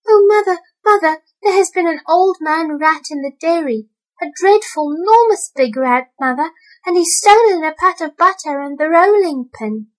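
high, loud frightened cry; a scared kitten calling mother. A narrator's reading. Mittens scrambling out of the jar, high and scared.

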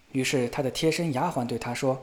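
ordinary delivery

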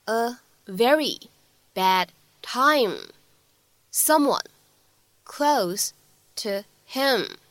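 each word of "I think I walked in on him" said apart, slowly; slow word-by-word read. Shown as a demonstration. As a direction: read it word by word, slowing right down. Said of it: "需要注意的发音技巧". (逐词放慢朗读)

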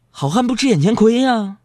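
ordinary talk, nothing marked

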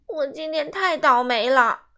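whining loudly about his bad day; loud wail. A narrator's reading. Simon complaining to grandma. (西蒙向奶奶大声哭诉)

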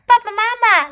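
high, loud call to the parents; excited child calling. A narrator's reading. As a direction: call out eagerly to mom and dad. Excited child calls her parents. (兴奋地高声呼唤)

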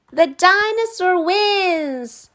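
put-on high, slow child voice; delighted children. A narrator's reading. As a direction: announce the winner. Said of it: "said the children".

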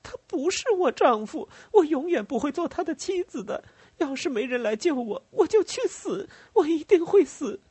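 very high female character voice; desperate refusal. Acted. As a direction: plead defiantly, pitched far up. Captive woman refuses forced marriage.

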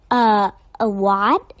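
stammering surprised shop assistant; startled exclamation. A narrator's reading. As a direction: blurt it out, caught off guard. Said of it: "exclaimed the assistant".

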